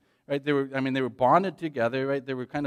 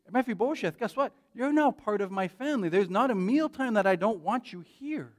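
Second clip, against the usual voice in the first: joyful welcome; 7 semitones higher than usual. Retold or imitated. David welcomes Mephibosheth.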